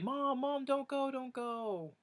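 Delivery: put-on very high, slow child voice begging; kids pleading. Children leaning out the car window begging their new mom, high and pleading. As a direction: call after her like a little kid.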